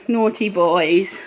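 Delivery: normal talking voice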